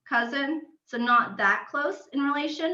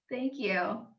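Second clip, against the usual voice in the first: ordinary delivery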